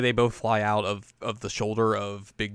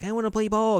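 put-on high little-kid voice; baby Inuyasha; 11 semitones higher than usual. Host voicing a child who wants to play, high and childlike. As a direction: pitch it way up like a small child. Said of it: "flashback to baby Inuyasha".

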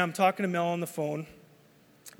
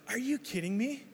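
incredulous thought; 6 semitones higher, 4 dB quieter than usual. Retold or imitated. Rear-ended, first thought.

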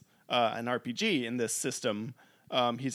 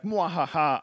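mock villain cackle; hammed-up evil mwahaha; evil laugh; 4 semitones higher, 4 dB louder than usual. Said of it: "evil Bakura is like".

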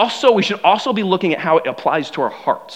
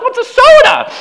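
much higher, louder laughing question; baffled kid; 7 dB louder than usual. Retold or imitated. New kid hearing soda for the first time, high laughing disbelief. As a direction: squeak it out in total disbelief. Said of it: "I'm like".

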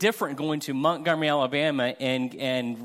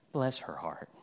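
catty aside; 8 semitones lower, 8 dB quieter than usual. Retold or imitated. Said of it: "looks to her friend and says".

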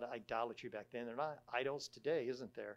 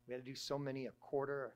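ordinary talk

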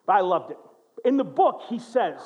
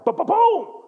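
higher, louder sudden boom; dramatic boom; 9 semitones higher, 6 dB louder, slower than usual. Acted. Preacher dramatizing the heart attack, loud. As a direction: hit boom like the attack striking.

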